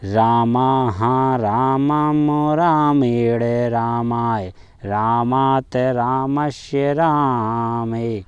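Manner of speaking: slow drawn-out chant of each form; slowly. Shown as a demonstration. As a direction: say every word slowly so it sticks. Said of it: "आपको धीमे धीमे बोलना है". (धीमे धीमे बोलना)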